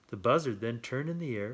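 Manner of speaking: ordinary delivery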